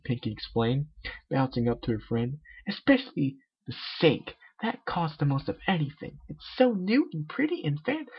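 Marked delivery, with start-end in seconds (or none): 2.7-8.1 very high, bubbly cartoon pony; Pinkie Pie voice. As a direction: squeak it out like a hyper party pony.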